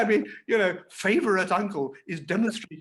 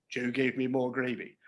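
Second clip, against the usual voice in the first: ordinary talk, nothing marked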